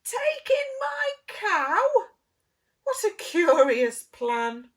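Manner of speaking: very high, loud, slow shocked cry; astonished old lady. Acted. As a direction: shriek at the absurd idea.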